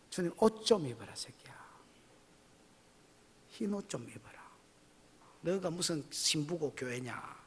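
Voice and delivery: unvoiced whispered scolding, slowing at the end; hushed rebuke. Preacher voicing the Lord's rebuke in a whisper. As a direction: hiss the rebuke under your breath.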